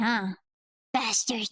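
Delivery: ordinary talk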